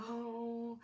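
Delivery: high, soft, drawn-out meek voice; exaggerated slow, sweet meekness; mock meek woman. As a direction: put on a dainty, demure voice. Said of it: "every woman is supposed to be meek".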